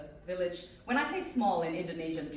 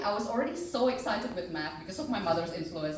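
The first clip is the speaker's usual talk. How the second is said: usual speaking voice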